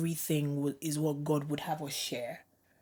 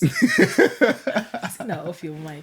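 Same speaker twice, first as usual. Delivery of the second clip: laughing through the words; 8 dB louder, much slower than usual. Real, not acted. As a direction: protest while laughing. Laughing protest at husband.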